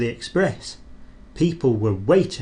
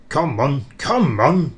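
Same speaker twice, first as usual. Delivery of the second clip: puffing big engine; 2 semitones higher, 4 dB louder than usual. Acted. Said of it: "puffed Gordon to the coaches".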